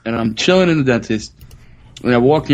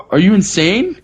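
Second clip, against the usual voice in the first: higher-pitched mock indignation; outraged parent; 6 semitones higher than usual. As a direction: imitate a parent telling someone off. Acted angry parent confronting him.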